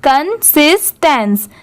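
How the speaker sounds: Consistence slowly, syllable by syllable; slow teacher's model of consistence; repeat-after-me model. Shown as a demonstration. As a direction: break consistence into "kun-sis-tens". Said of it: "मेरे बाद repeat करिए".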